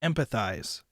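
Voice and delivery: "Empathize" said cleanly, em-pa-thize; correct pronunciation. Shown as a demonstration. Proving to chat he can say it, clean empathize. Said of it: "See, I said it. Perfect".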